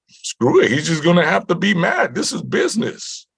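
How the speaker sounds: retold dismissiveness. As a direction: wave it away as a brash manager, imitated. Manager dismisses the guy's anger.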